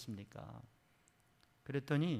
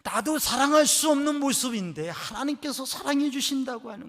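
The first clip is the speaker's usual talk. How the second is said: preaching at full force; 12 semitones higher, 17 dB louder than usual. Acted. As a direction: lift it up to the rafters.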